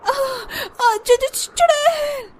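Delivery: stammering, high-pitched scream of terror; frightened cry. Acted. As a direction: stutter in fright, then let out a high wail. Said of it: "हल्का चिल्लाती ही है". (डर से हकलाती हुई चीख)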